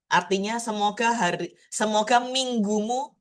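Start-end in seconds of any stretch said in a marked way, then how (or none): none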